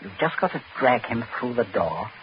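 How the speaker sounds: soft, cold, low instructions; calm and chilling, quietly in control; low menace. Acted. Prince quietly orders Smollett to dump the body, cold menace. Murderer calmly instructs captive.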